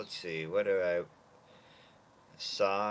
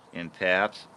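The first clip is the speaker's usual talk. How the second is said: ordinary talk, nothing marked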